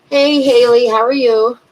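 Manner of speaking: bright, loud call out; loud greeting. Real, not acted. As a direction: call out a cheerful hello. Greets a viewer in the stream.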